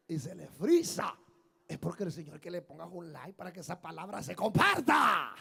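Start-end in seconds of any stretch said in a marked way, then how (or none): 1.8-5.3 bursts into a shout at the end; emphatic exhortation. Real, not acted. Preacher urging likes so the word spreads, emphatic shout. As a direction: swell to a loud final word. Urging church to share posts.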